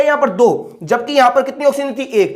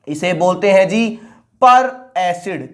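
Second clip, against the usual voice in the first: slow, drawn-out answer; 5 semitones lower, slower than usual. Shown as a demonstration. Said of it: "साफ साफ लिख देता हूं".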